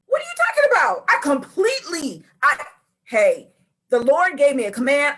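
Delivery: high, loud flustered protest; sputter, then insist you did it all; indignant denial. Acted. Preacher plays Saul denying his disobedience, indignant.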